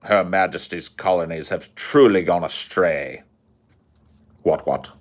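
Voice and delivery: put-on a pompous British gentleman sneering at the colonies, posh British accent; Sargon's posh British accent. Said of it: "I can't do the accent anymore".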